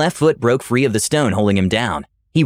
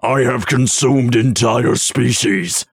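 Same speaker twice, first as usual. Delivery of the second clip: monster voice; slower than usual. Acted. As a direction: boast like a world-eating beast. Monster boasts of ending worlds.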